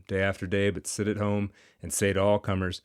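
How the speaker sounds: ordinary delivery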